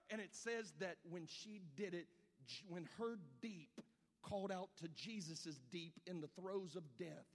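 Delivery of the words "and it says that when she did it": ordinary talk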